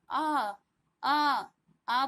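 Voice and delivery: आ held long and firm; long drawn-out vowel. Shown as a demonstration. Teacher showing double time, long आ. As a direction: stretch आ to twice the length of a short vowel. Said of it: "अ से दुगना समय ले रहा है".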